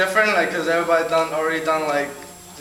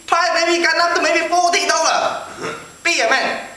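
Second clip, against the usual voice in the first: put-on high Chinese-accented shopkeeper threat; 8 semitones higher than usual. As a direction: raise the stakes in a mock Chinese accent. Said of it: "he totally started telling me this thing".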